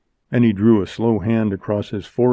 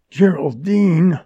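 higher, drawn-out protest; shocked exclamation; 7 semitones higher, 4 dB louder, slower than usual. Acted. Montgomery protests at Jerry's disbelief, shocked. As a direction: stretch the name out, taken aback.

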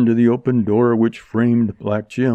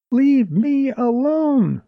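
high, drawn-out wail; long high cry of protest; much slower than usual. A narrator's reading. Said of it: "wailed Jerry".

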